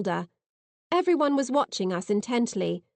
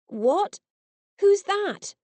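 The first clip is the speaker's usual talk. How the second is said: startled; 10 semitones higher, much slower than usual. A narrator's reading. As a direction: jolt awake in alarm. Woken, startled.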